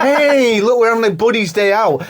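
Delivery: high, jolly mock voice; mock chummy buddy. Host mocking the other reading of the line, high and chummy. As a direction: overplay a pally day out. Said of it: "he's not like, it's just like".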